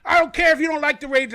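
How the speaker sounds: imitating a fan yelling in her team's defence; mock yell. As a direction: stand up for him with a yell. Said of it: "she starts yelling".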